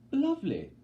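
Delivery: a bright showy hostess; Effie's voice. Acted. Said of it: "says Effie Trinket".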